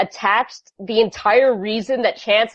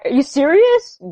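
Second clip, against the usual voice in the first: incredulous; 10 semitones higher than usual. Retold or imitated. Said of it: "Chet's like".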